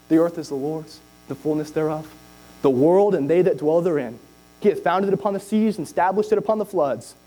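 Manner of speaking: low reverent recitation; scripture reading. Acted. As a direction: read it out like holy writ.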